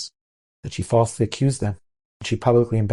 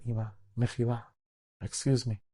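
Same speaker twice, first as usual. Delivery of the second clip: soft, restrained plea to mother; calm her down softly; gentle soothing; 10 dB quieter than usual. Retold or imitated.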